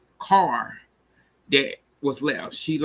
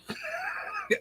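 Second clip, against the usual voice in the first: high laugh; 11 dB quieter than usual. Real, not acted. Reacting to his line.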